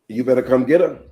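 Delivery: loud, firm telling-off; exasperated demand. Retold or imitated. Mother ordering Kia to fetch her daughter, loud and fed up. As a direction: insist someone come take the child. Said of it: "that's the nonsense she said to me".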